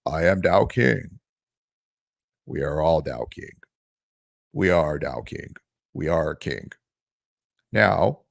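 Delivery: very low, slow mocking monotone; deep plodding voice with long breaks; slow droning YouTuber. Mimicking a boring crypto creator's intro, deep slow drone. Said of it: "he takes long breaks".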